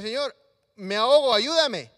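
loud, pleading drowning cry; desperate cry for help. Acted. Pedro sinking in the sea, shouting to the Lord for help, loud and desperate. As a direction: call out as if going under.

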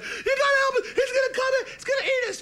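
very high, loud shrieking plea; terrified squealing pigs. Acted. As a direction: squeal for help as if about to be eaten.

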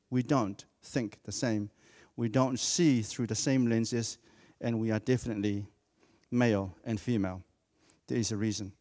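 ordinary talk

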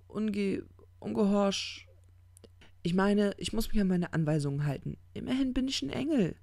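stumble, then hurry through the excuse; flustered angel. Acted. Aziraphale stumbles over his orders.

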